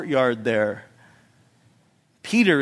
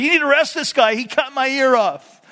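high, louder indignant Malchus; mock complaint; 9 semitones higher, 4 dB louder than usual. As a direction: whine it like an outraged victim.

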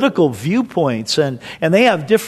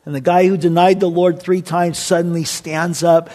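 ordinary delivery

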